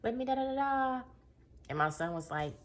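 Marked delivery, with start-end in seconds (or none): 0.0-1.0 high-pitched, sing-song customer voice; lifted, sustained high phone-order greeting; changed ordering voice. Retold or imitated. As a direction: put on a much higher voice for ordering. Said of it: "change your voice".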